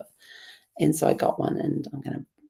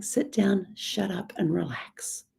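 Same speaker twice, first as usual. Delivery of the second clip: whispering; 14 semitones higher, 4 dB quieter, slower than usual. Retold or imitated. Her croaky voice's advice.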